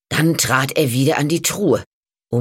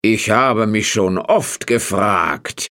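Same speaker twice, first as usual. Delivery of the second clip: lower, slow, wondering farmer voice; curious farmer; 5 semitones lower, slower than usual. A narrator's reading. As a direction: draw out the curiosity slowly.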